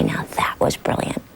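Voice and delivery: hushed whispered praise. Retold or imitated. Director whispering praise to the calmed child actress. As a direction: whisper the praise gently, close in.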